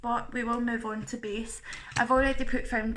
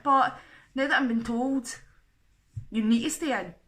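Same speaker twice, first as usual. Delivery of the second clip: normal talking voice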